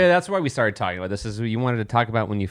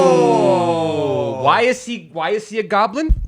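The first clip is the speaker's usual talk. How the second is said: excited; 7 semitones higher, 5 dB louder, slower than usual. Real, not acted. Sudden excited question.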